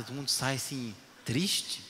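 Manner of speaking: ordinary delivery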